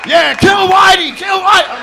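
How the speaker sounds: loud, high shouted chant; rabble-rousing yell. Acted. Comedian siding with Latin Americans, shouted mock rallying cry. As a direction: yell it like you're rousing a mob. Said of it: "I got away with yelling".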